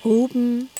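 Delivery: normal talking voice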